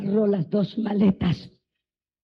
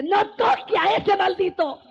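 high shouted warning, a mother's fury; 10 semitones higher than usual. Retold or imitated. Mother forbids the father to hug the son, high furious shout. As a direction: shriek it to stop him touching.

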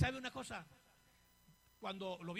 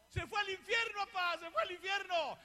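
high, raised mock wail; imitated anguished cry; 7 semitones higher, 6 dB louder than usual. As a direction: retell it as a loud, high lament. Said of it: "Vieras cómo lloró".

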